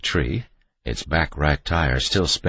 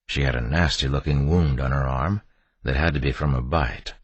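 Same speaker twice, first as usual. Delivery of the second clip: ordinary delivery, nothing marked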